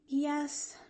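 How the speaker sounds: high, small sighed answer; sighing. A narrator's reading. Girl answering the doctor about her aunt, sighing. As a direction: let it out on a sigh. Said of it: "sighed Pollyanna".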